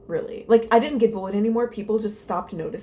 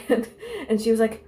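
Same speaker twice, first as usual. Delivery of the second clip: normal talking voice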